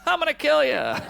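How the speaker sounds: mock killer threat. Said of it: "threat to friend".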